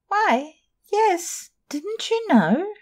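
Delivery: put-on high girl's voice, slowing at the end; young dancer. A girl answers Sorelli, high and wide-eyed.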